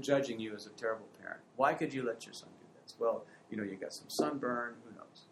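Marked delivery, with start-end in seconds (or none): none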